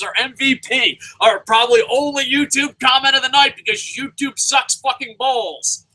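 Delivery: high-pitched excited disbelief; worked-up, high amazed rant; astonished outburst. Real, not acted. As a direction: exclaim as if a miracle just got through. Comments suddenly appear, he exclaims.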